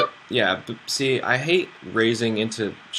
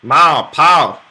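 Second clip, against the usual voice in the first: put-on Southern accent; 5 semitones higher, 8 dB louder than usual. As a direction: holler like a mock Southern backwoods kid. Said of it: "like, Southern accents?".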